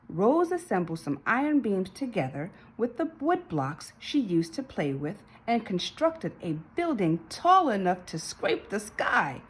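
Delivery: ordinary delivery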